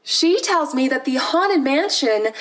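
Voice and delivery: normal talking voice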